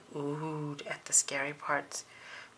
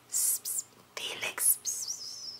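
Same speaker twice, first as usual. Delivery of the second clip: soft unvoiced psst-psst; whisper; 12 dB quieter than usual. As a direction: hiss his name under your breath. Classmate whispering to Felix.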